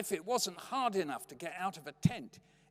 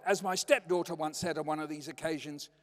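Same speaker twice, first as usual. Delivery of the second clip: ordinary delivery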